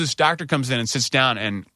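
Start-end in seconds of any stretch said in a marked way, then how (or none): none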